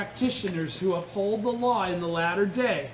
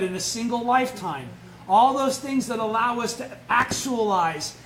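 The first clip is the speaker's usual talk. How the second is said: loud, fervent sermon build; forceful preaching; 2 semitones higher, 5 dB louder than usual. Acted. Teacher exhorting study group members about obstacles, loud fervent preaching. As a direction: drive the point home to the congregation.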